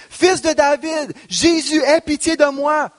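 high, pleading cry for mercy. Acted. Blind beggar calling out to Jesus, pleading cry. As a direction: call out to Jesus, begging. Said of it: "se mit à crier".

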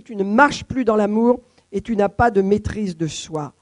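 ordinary delivery

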